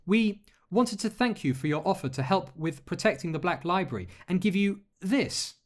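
put-on high, halting, embarrassed girl's voice; flustered young girl. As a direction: stumble over it, red-faced. Alex voice continues.

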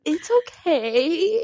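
drawn-out, high "it's okay"; mock reassurance. Friend imitating her half-convinced verdict on a boyfriend, mock reassurance. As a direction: tease with a slow, lilting reassurance. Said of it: "You're like".

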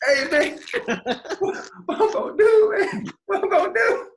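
high laughing plea; whiny pleading. Retold or imitated. Retold desperate phone call.

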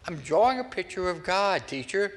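put-on high, bright child voice; eager little boy. Little boy telling teacher he draws God, lightly childlike. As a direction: pipe up like a proud kid.

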